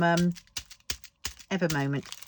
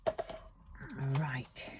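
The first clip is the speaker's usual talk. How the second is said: whispered; 3 semitones lower, 8 dB quieter than usual. Real, not acted. Murmured aside while sorting.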